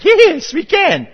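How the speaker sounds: high, loud eager yes. Retold or imitated. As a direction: jump at the chance. Wife eagerly answering.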